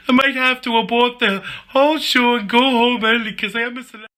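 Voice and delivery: high-pitched put-on whiny contestant; complaining contestant.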